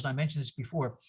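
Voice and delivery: ordinary talk, nothing marked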